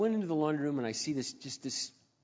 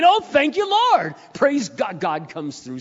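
much higher and louder, fading down; overjoyed believer thanking the Lord; joyful praise; 8 semitones higher, 12 dB louder, slower than usual. Retold or imitated. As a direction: burst into thanks, then settle. Relieved praise after commercial.

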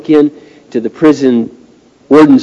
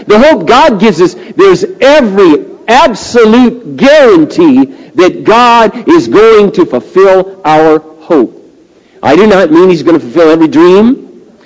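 raised, emphatic pulpit voice; impassioned preaching; 5 semitones higher, 5 dB louder than usual. Real, not acted. Preacher explaining God's hope to the congregation, impassioned preaching. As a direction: drive each point home, pitched high. Preaches scripture at full force.

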